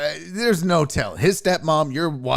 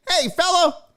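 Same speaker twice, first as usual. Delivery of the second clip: put-on high, hearty old man voice, way up; creepy dad voice; 11 semitones higher than usual. Dad lecturing the kid on the plane, high hearty voice. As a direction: play the chummy preachy dad. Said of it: "old man being like".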